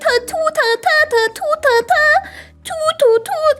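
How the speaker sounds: high sung ambulance siren; imitated siren.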